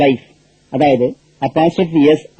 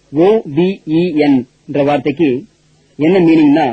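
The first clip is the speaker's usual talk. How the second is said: ordinary delivery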